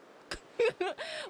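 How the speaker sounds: high, loud laugh; burst of laughter. Real, not acted. Streamer alone at her game, sudden high laugh. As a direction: break into a sudden high cackle.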